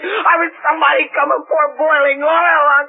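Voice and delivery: high, crying, furious outburst; tearful rage. Acted. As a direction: curse them through tears. Inch rages at the departing gang.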